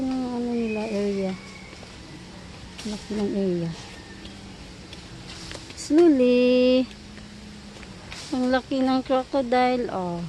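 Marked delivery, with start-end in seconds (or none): 5.7-6.9 loud, drawn-out warning; raised-voice stretched caution. Real, not acted. Cautioning the child.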